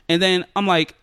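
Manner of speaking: ordinary talk, nothing marked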